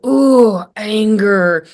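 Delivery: loud "ooh", then a mock-angry word; mimed anger. Shown as a demonstration. Mother naming her son's mimicked emotion, mimed anger. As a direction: playfully act out anger in the tone. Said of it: "use my tone and my words to say the emotion".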